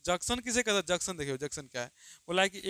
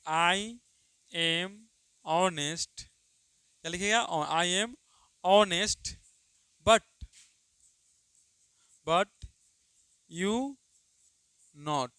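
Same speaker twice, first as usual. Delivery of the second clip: word by word, with long gaps; slow dictation; much slower than usual. Shown as a demonstration. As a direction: read it out so students can write. Dictates example sentence. (धीमे-धीमे बोलकर लिखवाना)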